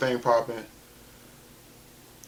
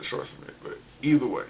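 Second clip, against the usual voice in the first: ordinary delivery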